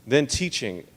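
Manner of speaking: ordinary talk, nothing marked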